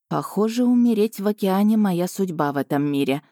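ordinary delivery

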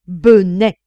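loud, contemptuous exclamation; scornful insult. A narrator's reading. Fifth friend rebuking the narrator, loud scorn. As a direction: throw the word at him.

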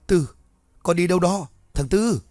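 put-on old man crying out in his sleep; shouting a name. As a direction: yell after him as he vanishes. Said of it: "nói lớn như vậy".

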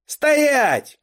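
high, loud drawn-out command; shout. A narrator's reading. Boy raises his cube at the girl, commanding shout. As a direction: shout to halt someone in their tracks. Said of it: "крикнул Кай".

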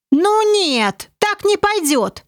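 high, indignant protest. A narrator's reading. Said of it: "возмутился командор".

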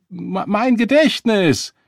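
high, loud, drawn-out stammer; stumble, then wail the excuse slowly; flustered protest. A narrator's reading. Beggar pressed about work blames his memory, flustered protest.

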